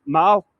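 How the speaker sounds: "mall" with a short front a, rhyming with "pal"; Irish "mall". Shown as a demonstration. Guide repeating the Irish word for viewers, "mall" with short a. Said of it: "We say mall in Ireland".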